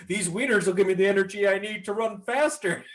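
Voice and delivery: high, put-on cartoon exclamation; hammed-up determination. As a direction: declare it like a cartoon hero mid-escape. Acts out Homer with sausages.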